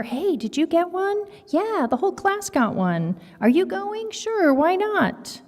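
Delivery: put-on high, chirpy kid voices; classmates chatting. Classmates swap party news in high kid voices. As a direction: voice excited classmates trading party gossip.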